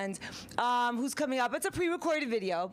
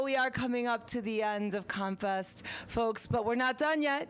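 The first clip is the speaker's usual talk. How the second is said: normal talking voice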